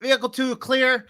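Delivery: loud, high checkpoint shout; guard calling clear. Acted. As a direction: call the vehicle through to the gate crew. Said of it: "he goes".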